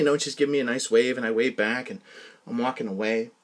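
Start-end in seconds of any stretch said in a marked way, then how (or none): none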